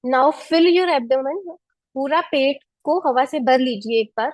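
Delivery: calm breathing-coach instructions; guided breathing. Acted. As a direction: lead the class through each breath. (शांत, धीमा श्वास निर्देश)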